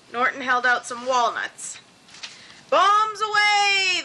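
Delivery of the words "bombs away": high, drawn-out rallying cry; playful battle shout. A narrator's reading. Norton and Luella hurling walnuts at the space station, shouted. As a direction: call it out long, high and loud. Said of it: "they shouted as they hurled the walnuts".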